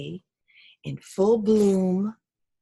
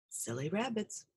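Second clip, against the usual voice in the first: much softer dismissive owl; owl muttering; 12 dB quieter than usual. Acted. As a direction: murmur it as you fly away. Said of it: "said the owl".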